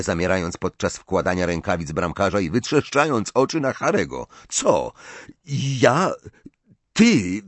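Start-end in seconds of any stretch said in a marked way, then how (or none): 4.5-7.4 halting, startled single words; stammering, slightly scared. A narrator's reading. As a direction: falter with nervous excitement. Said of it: "wyjąkał z miną trochę wystraszoną".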